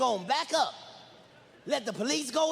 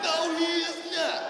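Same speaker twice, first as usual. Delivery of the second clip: slow high emphatic no; emphatic denial; 7 semitones higher, slower than usual. Real, not acted. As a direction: lean hard on every word. Emphatic punchline.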